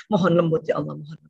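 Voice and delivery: low, fading plea to God; softly murmured supplication; pleading prayer. Shown as a demonstration. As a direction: pray under your breath for gentleness. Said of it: "Mohon sama Allah".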